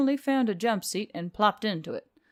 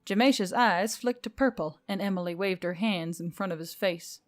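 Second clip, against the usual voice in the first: plain reading voice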